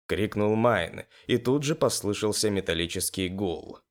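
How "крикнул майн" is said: usual speaking voice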